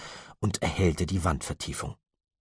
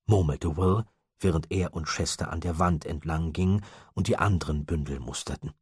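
plain reading voice

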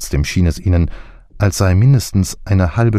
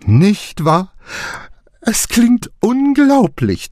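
much higher, slow, gleeful; triumphant delight; slower than usual. A narrator's reading. Clancy to Poirot, triumphant and thrilled. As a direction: crow over it in triumph. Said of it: "triumphierte Clancy ganz begeistert".

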